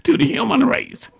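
high scolding granny voice; high-voiced scolding grandmother. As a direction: pitch it way up like the angry grandma. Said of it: "she said".

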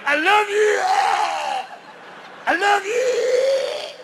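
high, drawn-out shouted crooning; drunk lover. Acted. Drunk man declaring love in bed, high drawn-out wail.

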